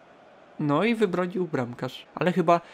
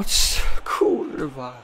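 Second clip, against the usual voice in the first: deflated swearing; 5 semitones lower, much slower than usual. Real, not acted. Reacts to the missed chance.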